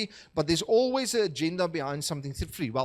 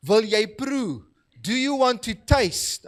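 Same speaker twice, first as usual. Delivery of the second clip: put-on eager kid offering, higher and louder; excited child; 5 semitones higher, 6 dB louder, slower than usual. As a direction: play the thrilled little boy sharing his drink. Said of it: "He turns to his siblings and say".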